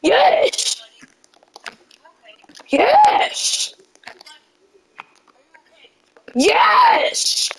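high, squealed cries of "yes"; jubilant cheering. Real, not acted. Player celebrating hits in the game, jubilant cheering. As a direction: cheer each one, pitch leaping up.

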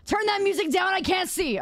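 high-pitched comic demand; high, exaggerated grumbling order; mock complaint. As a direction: yell it like a cranky grump. Mock complaint about loud music.

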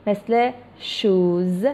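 shoes drawn out very slowly with a buzzing z; slow model of shoes ending in z; plural z sound. Shown as a demonstration. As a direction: stretch shoes so the final z is clear.

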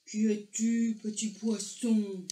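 slow, booming giant sea creature; big booming voice. A narrator's reading. Giant creature questions the little fish, big booming voice. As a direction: stretch the words out in a big, resounding voice. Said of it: "une grosse voix, pareille à mille tambours".

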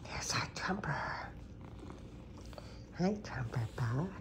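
soft whisper to the cat. Real, not acted. Owner whispering hello to her cat Trumpy, whisper. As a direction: hush the words to a sleepy cat.

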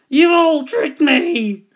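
loud, high, drawn-out accusation; howling. A narrator's reading. Dingo accusing the animals, howling. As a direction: howl it out slowly in outrage. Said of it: "he howled".

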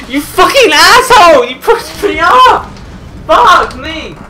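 high, loud outraged yelling; furious shout. Real, not acted. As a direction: yell at whoever just shoved you. Raging at player who pushed him.

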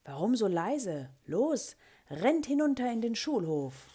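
loud, high urging; loud call. A narrator's reading. Teacher sends pupils running to the yard, loud call. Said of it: "rief ich laut".

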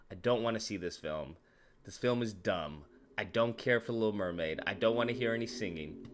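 low, quiet, dismissive grumble; grumbling reluctance. Retold or imitated. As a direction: retell it low and unimpressed. Retelling his reluctance before the film.

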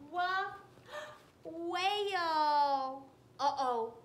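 ordinary delivery

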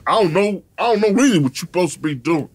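quick, laid-back mock [name], slightly off his own voice; [name] impersonation. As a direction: rattle it off like Cube shrugging it away. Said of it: "you kill me with the [name] a-, impersonation".

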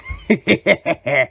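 short gloating villain laugh; evil cackle. Acted. Villain plotting to steal the formula, cackling. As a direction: cackle at your own wicked plan.

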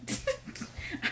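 small, quiet breathy chuckle; short laugh. Real, not acted. Reader giving up on the book, quietly laughing. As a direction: let out a soft little laugh. Laughs after saying she's done.

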